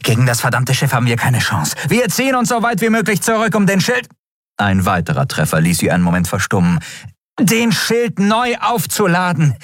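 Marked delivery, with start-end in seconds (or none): 0.0-4.1 high, fast, urgent battle orders; furious high-pitched commander; angry commander Elin. Acted. 7.4-9.6 high, slow, emphatic order; heated high command; angry commander. Acted. Commander resumes interrupted battle order.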